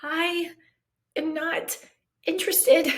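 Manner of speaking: each word split by pauses, high and pointed; I ... am not ... interested, spaced and final; firm refusal. Retold or imitated.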